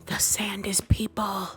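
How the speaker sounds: slow whispered reveal; dramatic whisper. Real, not acted. Storyteller revealing the sand is people, slow whisper. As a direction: whisper it like a shocking secret.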